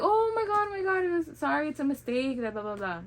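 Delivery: high, rushed flustered apology; mock the nurses panicking; mimicking flustered nurses gushing sorry; frantic nurses. Nurses panic over wrong bracelet.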